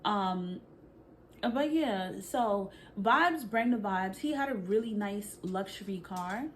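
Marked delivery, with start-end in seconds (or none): none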